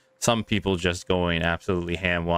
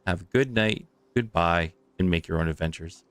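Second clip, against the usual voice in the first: ordinary delivery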